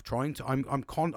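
ordinary talk, nothing marked

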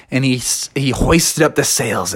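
ordinary delivery

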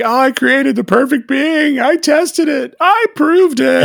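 high-pitched hammed-up proclamation; retold ranting. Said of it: "he's like".

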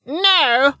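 put-on high, loud old woman's shriek. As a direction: shriek it in sudden rage. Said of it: "Lady Sale shrieked".

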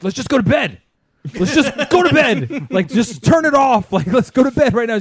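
high, loud re-enacted argument; retold yelling. Husband re-enacting a living-room fight with his wife, yelling. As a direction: yell it like a fed-up spouse. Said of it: "literally yelling at each other".

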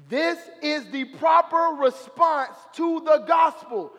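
rising sermon fire, near shouting; preaching climax, shouted; fiery preaching. Real, not acted. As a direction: preach it loud and high, building to a shout. Preaching at full force.

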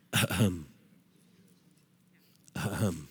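very soft, low throat-clear; gentle ahem. Retold or imitated. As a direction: nudge discreetly with a quiet cough. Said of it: "The Holy Spirit goes".

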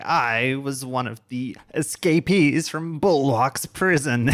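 slow, loud, high alien voice; alien villain voice. Acted. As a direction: declare it like a gloating alien villain.

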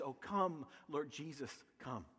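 low, soft plea, fading away; hushed prayer. Retold or imitated. Reading out a fellow pastor's closing prayer, hushed. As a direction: let the prayer sink almost to a hush.

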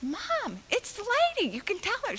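put-on high pleading child's voice; eager little girl. Child nagging her mother.

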